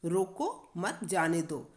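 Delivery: रोको, a break, then मत जाने दो as one phrase; pause after रोको. Shown as a demonstration. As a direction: stop after रोको before मत. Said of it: "अर्थ ही बदल गया". (रोको के बाद विराम)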